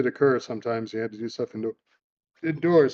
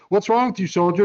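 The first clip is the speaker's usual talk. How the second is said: drill instructor; 6 semitones higher, 3 dB louder than usual. Retold or imitated. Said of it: "He goes".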